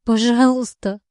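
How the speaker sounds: high hoarse tearful cry; desperate tearful begging, raw from screaming; after crying. Acted. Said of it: "Осипшим от криков и заплаканным голосом умоляет".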